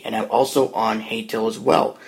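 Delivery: normal talking voice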